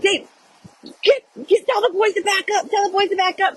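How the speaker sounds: high, urgent mock yell; frantic re-enacted orders, stumbling into repeats; retold scream. Telling Dave to move the boys, high mock scream. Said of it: "So I'm screaming to Dave".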